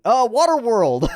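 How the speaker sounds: high, loud, drawn-out cry of recognition; excited exclamation. Real, not acted. Sudden delighted realization while reading.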